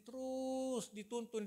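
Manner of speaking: "terus" stretched long and high; drawn-out emphasis. Real, not acted. Telling the congregation of God's constant leading, "terus" drawn out. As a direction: hold the vowel of "terus" to show it goes on and on. Stressing God's endless guidance.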